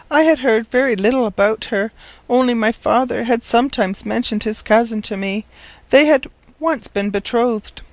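put-on high-pitched, earnest girl's voice; forlorn orphan girl. Orphaned girl explaining to the professor why she came, forlorn.

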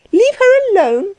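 very high, shrieking wife's echo; scandalized, high rising question; incredulous cry. A narrator's reading. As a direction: repeat it in high-pitched outrage. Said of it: "cried his wife".

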